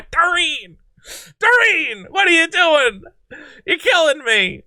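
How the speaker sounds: high-pitched comic exasperation; hammed-up, high exasperated plea; mock despair. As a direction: wail it in playful despair. Mock despair at the interviewee's blunder.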